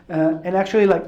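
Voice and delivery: usual speaking voice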